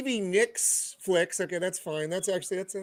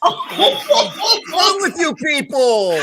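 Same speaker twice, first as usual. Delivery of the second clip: high, slow, laughing mock outrage; a laughing mock-scold to the slang writers; laughing exclamation; 7 semitones higher, 5 dB louder, much slower than usual. Real, not acted. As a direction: cry out through laughter in mock disbelief.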